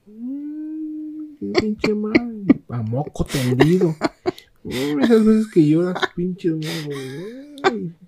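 lloriqueo agudo y alargado; llanto fingido. Retold or imitated. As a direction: gimotea exagerando el llanto. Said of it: "empecé a llorar". (imitando su llanto de borracho, agudo)

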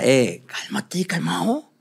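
slow, drawn-out "cálmate, calmado" in a changed voice; imitating his teacher. Teacher calming the fast-talking young clown, slow and drawn out. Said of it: "mi maestro me decía".

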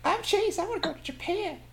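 very high, squeaky imitation; mock a friend in a tiny voice; squeaky mock Chase voice. Impersonating Chase mockingly.

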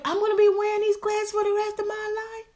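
put-on high, dismayed childhood wail; reliving a kid's horror at glasses for life; devastated disbelief. A child, crushed at the eye doctor, high dismayed cry. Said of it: "Me, I'm devastated".

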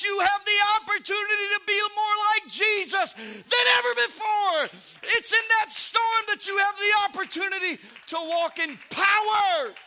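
loud, high, shouted sermon climax; full-force preaching. Acted. Preacher rousing the congregation about power in the storm, shouting. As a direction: preach it out at the top of your voice.

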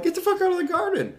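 very high, loud, fast order; shrieked evacuation warning; frantic yelling. Retold or imitated. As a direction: scream it in acted panic. Ordering friend out of garden.

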